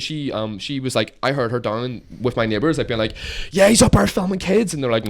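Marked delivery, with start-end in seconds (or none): 3.5-4.7 high-pitched mimicked accusation; mock tattling. Woman complains to the neighbours, high mock accusation. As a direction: imitate her loudly telling on someone.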